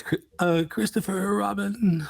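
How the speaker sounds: high, slow yawning Christopher Robin; host copying the guest's Pooh, yawning; Pooh Bear voice. Retold or imitated. Stretched yawn through the name. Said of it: "the way he says".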